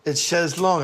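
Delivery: "chaise longue" as "shez long"; French pronunciation. Shown as a demonstration. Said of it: "It means long chair".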